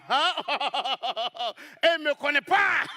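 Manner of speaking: laughing through il ne me connaît pas; laughing at his ignorance. Real, not acted. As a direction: burst out laughing.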